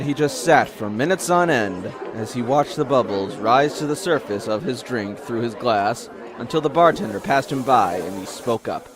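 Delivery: usual speaking voice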